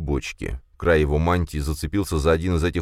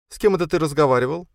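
suspicious sister; 11 semitones higher, faster than usual. Acted. Sister questions Frel suspiciously.

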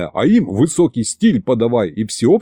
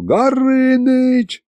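long high shout of Горыныч; drawn-out yell; 12 semitones higher, much slower than usual. Acted. Fedya yelling for the dragon, long drawn-out shout. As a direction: call Горыныч, stretching the name out. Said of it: "снова заорал".

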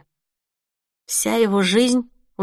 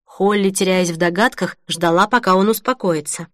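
plain reading voice

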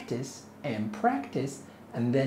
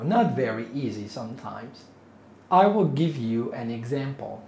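ordinary talk, nothing marked

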